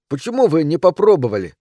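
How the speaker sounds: put-on high, loud child's question; protesting little boy. A narrator's reading. Mother recalling her son's question, indignant. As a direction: demand why as an upset boy.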